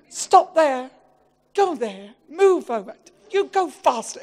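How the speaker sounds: loud barked instructions; bossy backseat orders. Retold or imitated. Husband imitating his wife snapping loud driving orders. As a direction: order him about like a driving examiner.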